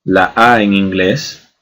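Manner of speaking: normal talking voice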